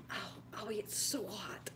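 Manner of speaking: breathy whisper at the hot steam. Real, not acted. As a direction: whisper it, wincing at the heat.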